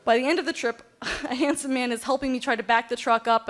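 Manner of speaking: ordinary delivery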